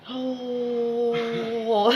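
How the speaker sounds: long audible exhale on "oh"; loud breath out. Shown as a demonstration. Showing students a breathing exercise, long loud exhale. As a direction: let the breath out noisily. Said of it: "Make it a loud breath".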